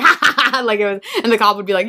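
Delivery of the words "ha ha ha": higher mock "ha, ha, ha"; retold gleeful laugh; imitated mischievous laugh. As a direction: imitate a troublemaker cackling at his victims. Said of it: "intentionally running into people and being like".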